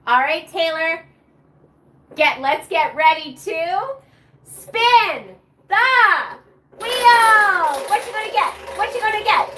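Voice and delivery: high, loud, sing-song chant of what you gonna get; game show hype. Acted. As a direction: whip up the crowd like a game show host. Hyping a live prize wheel spin.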